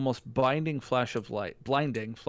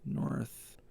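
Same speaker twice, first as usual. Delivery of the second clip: whispered repeat under the breath; 2 semitones lower, 13 dB quieter than usual. Real, not acted. Player echoing the partner's directions, whispered. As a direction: murmur it to yourself.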